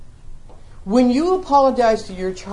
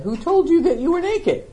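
God's question; 9 semitones higher than usual. Retold or imitated. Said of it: "And he said".

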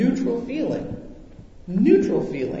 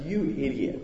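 muttered to self; 5 semitones lower, 6 dB quieter than usual. Retold or imitated. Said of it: "and I said".

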